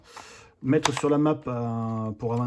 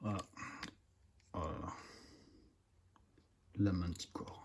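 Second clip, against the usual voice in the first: soft ASMR whisper; right in your ear; 6 semitones lower, 18 dB quieter than usual. Real, not acted. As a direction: close to the mic, hushed. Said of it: "moment A S M R".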